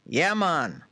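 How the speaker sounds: laid-back Jamaican "Yeah, man"; Jamaican accent. Pretend. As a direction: drop into a Jamaican voice. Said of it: "I want a Jamaican voice".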